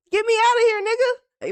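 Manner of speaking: hammed-up plea to escape; panicked coach. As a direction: imitate someone desperate to get out. Voices coach fleeing the club.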